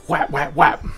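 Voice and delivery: quick, high, loud "whap" sound effects; imitated punch sounds. Bartender mimicking punches out of nowhere, punchy sound effects. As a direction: act out three smacks landing.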